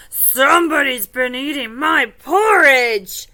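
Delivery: big, loud storybook bear voice; Papa Bear. Acted. Finding his porridge eaten, loud indignant father bear. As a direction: boom it out in indignant surprise. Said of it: "Papa Bear goes over to his bowl".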